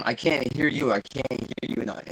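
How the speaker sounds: usual speaking voice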